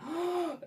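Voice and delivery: high frightened intake of breath; startled gasp; sharp gasp. Retold or imitated. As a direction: gasp as the shadow strikes a pose. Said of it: "And I went".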